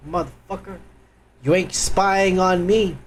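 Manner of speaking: ordinary talk, nothing marked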